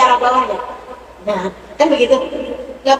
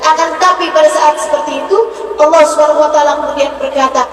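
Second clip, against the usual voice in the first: loud, raised sermon voice with music; fervent preaching; 7 semitones higher, 4 dB louder than usual. Acted. Preacher to the congregation, preaching loudly and fervently. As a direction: preach with rising passion.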